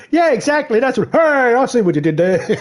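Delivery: mocking imitation. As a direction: squeal it like a smug know-it-all.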